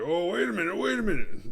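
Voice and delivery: high, loud buddy-cop protest; mock urgent interruption. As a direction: cut in with hammed-up urgency.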